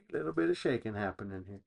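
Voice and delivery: shaken, quick and quiet; talking through the jostling; on a bumpy road. Real, not acted. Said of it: "We got some shaking".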